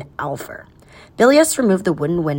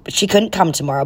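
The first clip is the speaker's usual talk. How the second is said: plain reading voice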